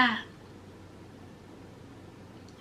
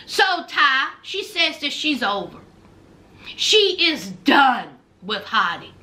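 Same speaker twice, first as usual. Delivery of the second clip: ordinary talk, nothing marked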